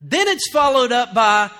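ordinary talk

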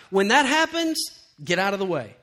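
ordinary talk

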